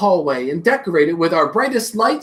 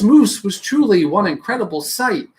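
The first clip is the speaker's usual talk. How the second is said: ordinary delivery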